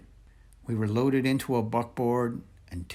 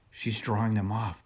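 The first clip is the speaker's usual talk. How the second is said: ordinary delivery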